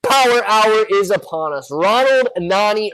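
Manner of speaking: booming hype announcer call. Acted. Stock stream hype opener.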